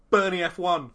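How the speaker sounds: loud, high mock shock. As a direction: cry it out in fake alarm.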